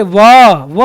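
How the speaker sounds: व stretched long; long, held व as a wrong example; drawn-out syllable. Shown as a demonstration. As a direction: drag व out instead of keeping it short. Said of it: "ऐसे पूरा नहीं".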